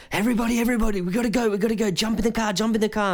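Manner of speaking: high, frantic urging to hurry; urgent. Retold or imitated. Local guy urging friends to flee, high and frantic. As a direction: hustle everyone out, panicked. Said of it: "he's like".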